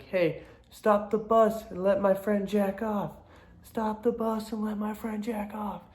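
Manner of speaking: high, drawn-out imitation of a classmate; kid calling out. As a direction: chant it from the back of class. Kid at back calls out shirt slogan.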